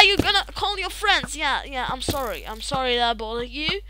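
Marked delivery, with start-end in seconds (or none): none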